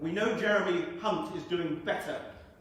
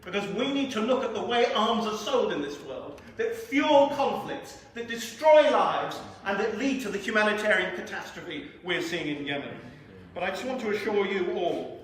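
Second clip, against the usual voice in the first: higher, louder platform voice with slowed key phrases; impassioned political oratory; 5 semitones higher, 6 dB louder than usual. Real, not acted. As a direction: drive each charge home forcefully. Impassioned speech against arms sales.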